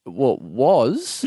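very slow dry reply; deadpan. Retold or imitated. Man answering the seat thief, very slow and dry. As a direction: drag it out flatly.

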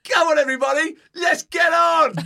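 high, loud rallying yell; mad excited shout. Retold or imitated. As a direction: imitate a wild guy on a table whipping up the crowd. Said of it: "He was absolutely mad".

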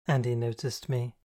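ordinary delivery

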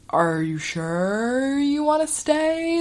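slow, high, rising question; doubtful. Retold or imitated. Counselor double-checks with the girl, slow high disbelief. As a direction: stretch it out skeptically.

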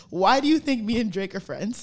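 normal talking voice

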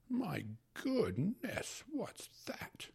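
slow amazed gasp fading to a whisper; a high, whispered what's that. A narrator's reading. Uncle startled by the steward's wife, amazed whisper. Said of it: "he asked".

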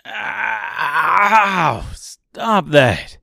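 high, loud pained shriek; screaming in pain. A narrator's reading. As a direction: cry out loudly as if being hurt. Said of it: "he screamed".

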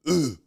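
loud imitated exertion grunt; short mock gym grunt; workout grunt. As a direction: grunt like you're lifting weights. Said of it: "when you work out, you go like".

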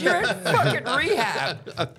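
high, slow, laughing jab; mocking girl. Girl at rehab laughs at his denial in a high, slow voice. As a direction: drag it out laughing in his face. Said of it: "She goes".

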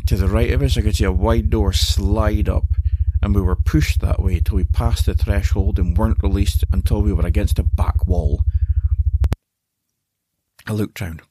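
plain reading voice

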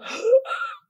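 very high gasping sob; retold gasp of someone crying; sobbing gasp. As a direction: gulp for air mid-cry.

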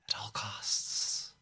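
breathy hushed whisper. Acted. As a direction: whisper it under your breath. Repeated as whisper.

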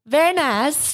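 light Borat impression; Borat accent. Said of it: "I just did the accent".

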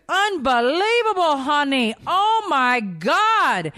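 put-on high, slow, amazed old lady; drawn-out, high and awestruck; awed elderly nanny. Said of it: "she's like".

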